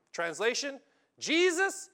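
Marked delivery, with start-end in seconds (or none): 1.2-1.9 high, loud call of a name; mock elders calling to Jesus. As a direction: cry out his name urgently.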